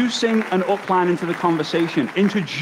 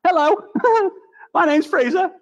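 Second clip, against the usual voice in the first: much higher, squeaky nervous greeting; nervous high hello; 13 semitones higher than usual. Retold or imitated. Young Fraser nervously greets the big Russian visitor. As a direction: squeak it out like a scared kid.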